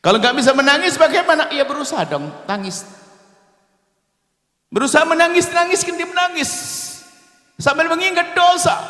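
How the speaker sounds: high fervent exhortation; impassioned preaching. Real, not acted. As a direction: push them to try weeping.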